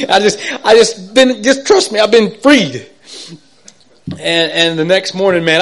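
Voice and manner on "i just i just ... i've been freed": higher and louder, tripping over the words; stumbling with joy. Retold or imitated. Man on the phone at midnight, tripping over words with joy. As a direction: gush so fast the words collide. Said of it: "I'm just stumbling all over myself".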